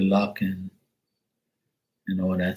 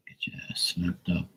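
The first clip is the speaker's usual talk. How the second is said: whispered grumble, much softer; angry whisper; 3 semitones lower, 11 dB quieter than usual. Real, not acted. Grumbling at the noisy car outside, in an angry whisper. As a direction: hiss a threat under the breath.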